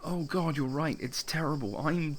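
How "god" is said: ordinary delivery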